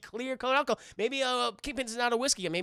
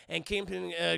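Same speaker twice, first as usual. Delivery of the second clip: ordinary talk, nothing marked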